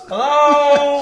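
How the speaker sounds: calling from across the room; far from mic. Shown as a demonstration. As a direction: shout it from far away. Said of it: "I'm over here".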